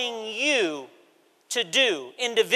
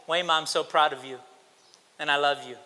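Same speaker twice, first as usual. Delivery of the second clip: softer, gentle fatherly voice; imitated tender dad; 9 semitones lower, 7 dB quieter, faster than usual. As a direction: say it like a proud dad, softly. Imitating dad's loving words.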